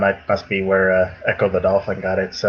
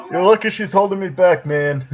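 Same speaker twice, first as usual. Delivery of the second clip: mock tough guy; 8 semitones higher than usual. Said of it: "letting his wife walk him away".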